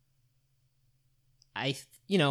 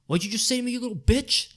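higher, louder mock snarl at the kid; imitating angry vendor; 10 semitones higher, 6 dB louder than usual. Vendor snapping at young manager, mock angry. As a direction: throw the vendor's insult back loud. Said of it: "Like, just like that".

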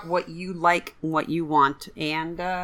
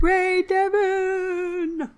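cheering; 13 semitones higher, much slower than usual. Real, not acted. Cheering the caller on.

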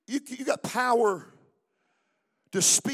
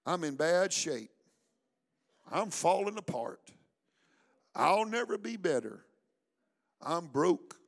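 gloomy self-talk; 6 semitones lower, 7 dB quieter than usual. Retold or imitated. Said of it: "these words is of your conversation".

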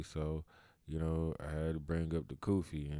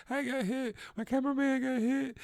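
much higher, whiny mock reporter; 3 dB louder than usual. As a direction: squeal it like a shocked reporter. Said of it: "the reporters like".

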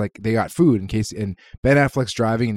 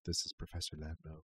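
soft, much quieter imitation; 5 semitones lower, 19 dB quieter than usual. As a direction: say it low and dignified. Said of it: "And he goes".

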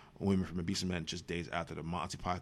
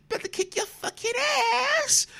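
squeaky high voice breaking into a laugh; laughing squeak; 6 dB louder than usual. Acted. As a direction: push the pitch way up and crack into laughter. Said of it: "I'm sounding like the little dude from Full Force".

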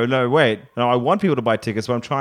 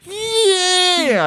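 high strangled cry of yeah; a yelp of shock at the phone; overwhelmed cry. Pretend. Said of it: "I'd be like".

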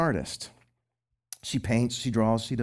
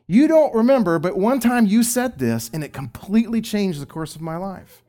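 higher and louder, eager and grateful; excited former teenager; 8 semitones higher, 6 dB louder than usual. Retold or imitated. Former teenager thanks his old youth pastor, excited. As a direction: gush like a grateful grown-up student. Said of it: "former teenagers tell me".

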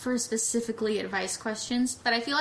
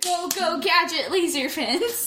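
gadget catchphrase; 6 semitones higher, slower than usual. Pretend. Quoting gadget catchphrase, calling out.

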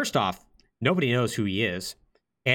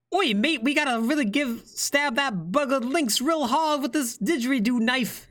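very high, mock Australian accent; bad Australian accent; 14 semitones higher than usual. Podcast host spoofs Kid urging a mate to stab Lynx: mock Australian accent. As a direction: squeak out a hammed-up Aussie accent. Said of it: "cannot do an Australian accent".

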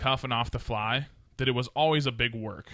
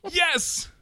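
excited yes. Real, not acted. Drunk host cheering.

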